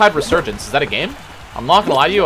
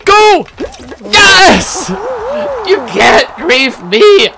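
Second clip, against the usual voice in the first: very high, loud celebratory shouts; triumphant shouting; 7 dB louder, much slower than usual. Real, not acted. Gamer who escaped the griefers, loud triumphant yelling. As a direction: cry out in high-pitched victory.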